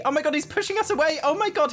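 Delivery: high, rapid exclamation; startled outcry. Real, not acted. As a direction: blurt it in amused alarm. Grim Reaper pushes her away.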